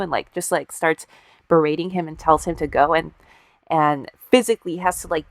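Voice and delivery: ordinary delivery, nothing marked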